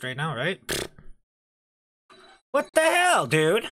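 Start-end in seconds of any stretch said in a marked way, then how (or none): 2.5-3.7 startled outburst. Real, not acted. Startled by game event.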